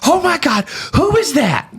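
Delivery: high, squealing mock of a coworker's voice; imitating excited woman on phone. Coworker on his earpiece recognizing his voice, high squeal. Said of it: "I heard on his earpiece".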